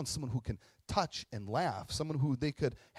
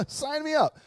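high, loud sarcastic cheer; mock eagerness; 14 semitones higher, 8 dB louder than usual. Joking volunteer for an awful life decision, mock eagerness. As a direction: sign up with hammed-up enthusiasm.

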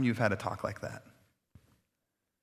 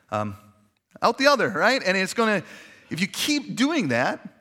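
ordinary delivery

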